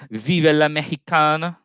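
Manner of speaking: over-the-top, badly faked Spanish accent, pitched high; mock Spanish accent as a bad joke toast; horrible Spanish accent. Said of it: "That was a horrible, um, Spanish accent".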